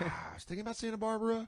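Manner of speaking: high, quick musing in a bit; mock pondering performer. Voicing shooter as pondering comic.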